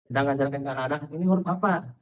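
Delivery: usual speaking voice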